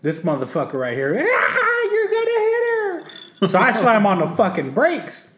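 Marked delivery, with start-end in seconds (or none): 1.2-3.5 high frantic scream of warning; panicked scream. Retold or imitated. Wife shrieks at husband driving at night, panicked. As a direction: shriek like you see someone about to be hit. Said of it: "she's bawling her fucking eyes out".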